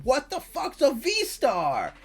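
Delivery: slow, high, drawn-out outraged yell; retold yell. Telling chat about the pack, slow outraged yell. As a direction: stretch it out like you're yelling to the room. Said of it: "I was yelling to everyone".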